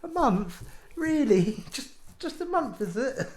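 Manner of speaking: high, pleading disbelief; defeated pleading. Retold or imitated. Retelling pleading with the retailer over the warranty, high and defeated. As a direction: whine the plea, half laughing. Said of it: "I just sounded defeated".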